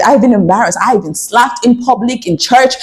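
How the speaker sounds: ordinary talk, nothing marked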